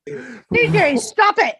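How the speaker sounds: high, loud cry of protest; playful scolding shout. Real, not acted. Telling C J to quit as everyone laughs, shrieked scolding. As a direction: call out loudly to make the joker stop.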